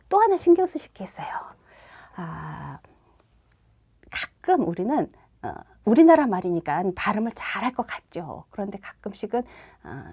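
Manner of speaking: ordinary talk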